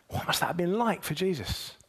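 ordinary talk

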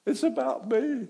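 high, loud confession; loud admission. Retold or imitated. Jonah to the sailors, a high, loud confession. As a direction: cry out the guilt. Jonah admits the storm's cause.

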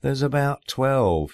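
dismissive wife voice. Retold or imitated.

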